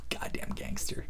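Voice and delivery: whispered, almost no voice; hushed awe. Real, not acted. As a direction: breathe it like a secret.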